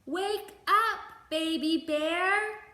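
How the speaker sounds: loud, high, slow wake-up call; shout. A narrator's reading. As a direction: call out loudly to wake the sleeping bear. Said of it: "They all shouted".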